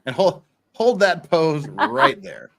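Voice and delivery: slow, laughing through the words; can't hold back the giggles. Real, not acted. Laughing while posing his co-host for the camera.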